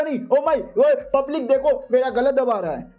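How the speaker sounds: high-pitched plea for help; hammed-up cry to the public; mock panic. A narrator's reading. Choked villain calls the public for help, mock panic.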